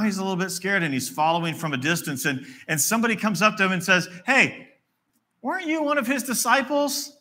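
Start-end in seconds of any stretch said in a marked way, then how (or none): 4.2-7.2 high-pitched acted challenge; accusing bystander. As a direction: call him out in a much higher voice. Bystander accuses Peter in story.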